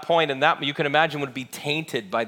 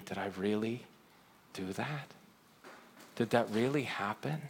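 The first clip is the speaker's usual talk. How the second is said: very soft, low, halting murmur; hushed regretful self-questioning; 4 semitones lower, 11 dB quieter than usual. Acted. Person waking to their worst regret, hushed disbelief. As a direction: question yourself almost under the breath.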